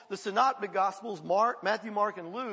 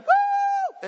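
a loud, high-pitched joyful whoop; David's wild cry of joy, high and loud; excited woo; 11 dB louder than usual. Retold or imitated. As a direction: whoop it out like dancing before the Lord. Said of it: "he got excited".

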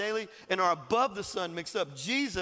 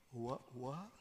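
stunned awe; 8 semitones lower, 16 dB quieter than usual. Acted. Awed prophets voiced in story.

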